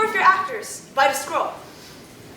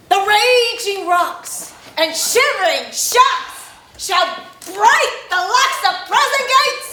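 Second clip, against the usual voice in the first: lofty tyrant's vein; 8 semitones higher than usual. Acted. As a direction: declaim it like a bombastic stage tyrant. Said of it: "This was lofty".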